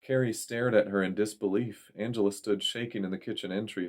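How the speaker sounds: plain reading voice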